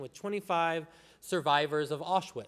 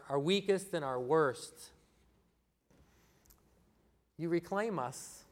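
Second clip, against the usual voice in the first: pastoral prayer. Acted. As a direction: lead the church gently in prayer. Leads the congregation in prayer.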